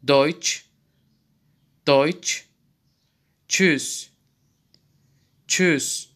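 Deutsch said doych and Tschüss said chooss; German tsch sound. Shown as a demonstration. Teacher demonstrating tsch loudly for students. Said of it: "ç diye okunmaktadır".

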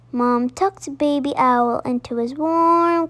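ordinary delivery, nothing marked

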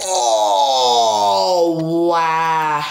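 loud, long drawn-out groaning cry; dismayed yell. Real, not acted. Gamer who just fell, loud drawn-out dismay. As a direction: bellow it, stretching both words. Falls in the game.

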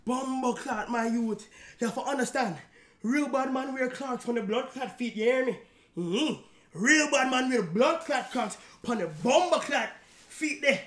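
playful Jamaican patois rant; mock Jamaican patois about Clarks. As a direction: lean into a jokey Jamaican accent.

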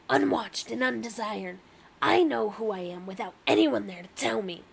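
forceful, aggressive Rat King in a story. Acted. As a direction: tell it as a hard-pushing rat character. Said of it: "that is an aggressive voice".

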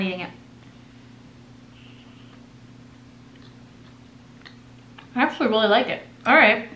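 chewing between words; talking around food; mouth partly full. Real, not acted. Seen 0: Bites before; lips closed and working at 0.8–1.4s, then clear speech.

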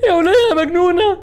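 high, slow laughing wail; laughing panicked cry. Real, not acted. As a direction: cry out half-laughing in fear. Laughing panicked cries at game. (صريخ وسط الضحك)

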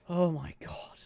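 whispered oh my god; awed whisper. Real, not acted. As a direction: breathe it out in disbelief. Fish keeps running away.

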